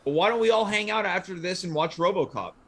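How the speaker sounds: ordinary talk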